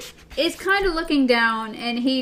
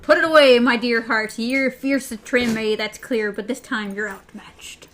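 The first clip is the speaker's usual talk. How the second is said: lightly voiced pirate, louder at first; 5 dB louder than usual. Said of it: "he also talks like a pirate".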